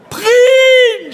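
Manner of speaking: high, very loud scream. Retold or imitated. Caller screaming at the phone robot, loud scream. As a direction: scream the station name in despair. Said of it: "schrie ich".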